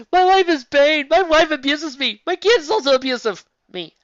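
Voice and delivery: over-the-top mock sob story; mocking whine. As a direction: whine it like a hammed-up martyr. Mock quote of the character's complaint.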